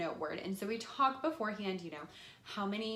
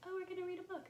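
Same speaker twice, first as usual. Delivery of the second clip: bored, flat; 10 semitones higher, 7 dB quieter, much faster than usual. Shown as a demonstration. Teacher shows the boring way to present books. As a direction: say it like you don't care. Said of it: "so I'm not just like".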